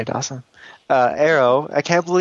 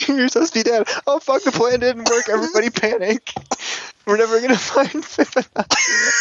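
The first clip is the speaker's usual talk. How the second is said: much higher, laughing mock panic; mock panicked villain; 10 semitones higher than usual. A fan spoofing a villain's panic in a squeaky voice.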